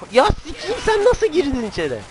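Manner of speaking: very high, startled yelp; startled outrage. Real, not acted. Player surprised by an intruder inside, high startled outburst. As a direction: shriek it in disbelief, then tail down.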